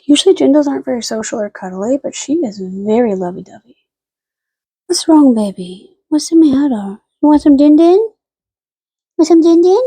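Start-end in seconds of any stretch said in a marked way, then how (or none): 4.9-9.8 high, louder sing-song din din; cooing pet voice. Real, not acted. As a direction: coax the dog to eat. Offering the dog dinner.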